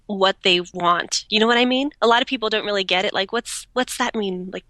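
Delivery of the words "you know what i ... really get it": ordinary talk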